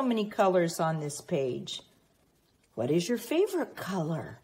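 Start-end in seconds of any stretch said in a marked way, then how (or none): none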